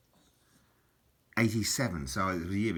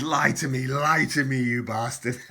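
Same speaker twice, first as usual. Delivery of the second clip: loud, higher-pitched mock pleading, breaking into laughter at the end; hammed-up punchline, raised and urgent; joke character's demand; 6 semitones higher, 7 dB louder than usual. Girl to Pinocchio in a dirty joke, loud mock demand. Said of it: "she goes".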